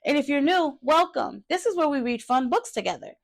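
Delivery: put-on bright, upbeat welcome to young viewers; cheery kids' host greeting. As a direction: greet the little readers like a kids' show host. Channel greeting to young readers.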